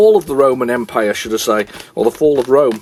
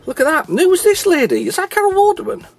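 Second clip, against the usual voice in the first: surprised. Real, not acted. Host squinting at the box cover, surprised and high. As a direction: voice jumping much higher in disbelief.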